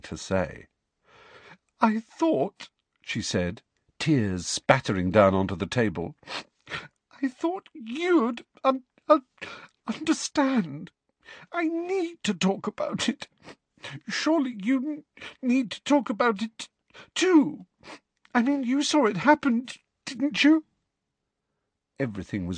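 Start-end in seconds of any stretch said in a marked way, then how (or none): 1.8-2.7 put-on high, tearful girl voice; a girl near tears; tearful Cho. A narrator's reading. 7.2-20.6 put-on high, tearful, stammering girl; a girl choking back tears; tearful Cho. A narrator's reading. Cho crying, voiced high.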